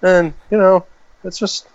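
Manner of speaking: high, light American accent impression; imitating Tom Holland's American accent. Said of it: "I'm doing his American accent".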